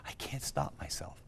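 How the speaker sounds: hushed, breathy whisper of a broken man; tearful whisper, barely voiced; whispered despair. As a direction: whisper it like you're at the end of your rope. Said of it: "And in tears on the phone".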